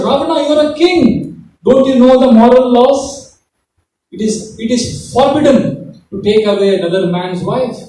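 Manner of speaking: high, loud, stern rebuke; stern sermonizing. Acted. Old bird Jatayu rebuking King Ravana, high and loud. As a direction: lecture the king on moral law. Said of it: "Jatayu was sermonizing him".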